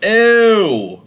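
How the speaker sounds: loud, high drawn-out disgust; real grossed-out yelp. Real, not acted. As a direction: recoil with a long, revolted cry. Disgusted reaction to eating straight butter.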